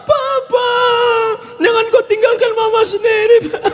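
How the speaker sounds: hammed-up, very high wail; mock wailing. Widow crying out to her dead husband, hammed-up wailing. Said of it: "ada satu ibu mau nangis".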